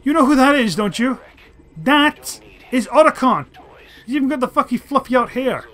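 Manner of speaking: thrilled shouting. Real, not acted. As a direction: burst out spotting a hero from the past. Spots Otacon, thrilled.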